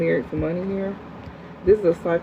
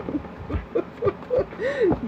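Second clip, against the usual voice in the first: high mocking laugh; 6 dB quieter than usual. Real, not acted. Laughing at the commenter.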